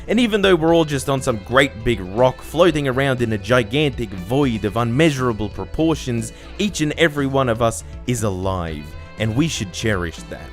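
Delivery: usual speaking voice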